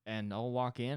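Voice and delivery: normal talking voice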